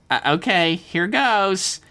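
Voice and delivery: put-on high, strained loser-kid voice, halting starts; nervous teenage Dev. A narrator's reading. Dev announcing his lift to the room, high and nervous. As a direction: stretch it out high and hesitant. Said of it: "Dev said loudly".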